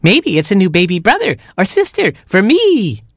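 put-on high, hopeful baby chick; piping chick, eager cry. A narrator's reading. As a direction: exclaim it in a squeaky little voice. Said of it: "cried Baby Chick".

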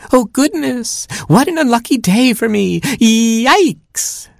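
very high, squeaky little bird voice; twittering chickadee. Acted. Hapless chickadee wailing over an unlucky day, very high twitter. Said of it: "twittered the hapless chickadee".

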